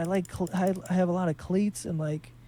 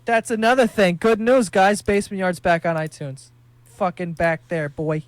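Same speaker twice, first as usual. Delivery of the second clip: louder, pumped good-news shout-out; excited announcement; 8 dB louder than usual. Real, not acted. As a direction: hype up the comeback news. Announcing podcast is back.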